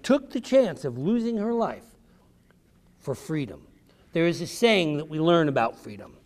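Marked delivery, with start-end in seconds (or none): 3.0-3.7 hushed. Real, not acted. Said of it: "hushed reverent close".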